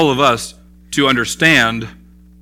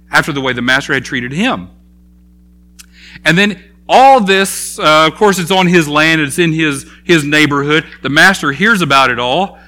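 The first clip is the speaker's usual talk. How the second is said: ordinary talk, nothing marked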